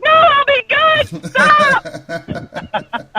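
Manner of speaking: put-on high, slow pleading kid voice; imitating scared son. Dad imitating his son begging, in a high slow plea. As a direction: plead like a kid facing the spoon. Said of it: "And he's like".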